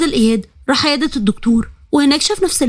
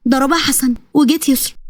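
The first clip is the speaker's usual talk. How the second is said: ordinary delivery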